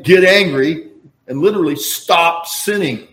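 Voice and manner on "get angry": loud rising "Get angry"; forceful command. Retold or imitated. Preacher quoting the scripture's command, loud. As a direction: urge the crowd to get angry. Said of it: "because it's saying".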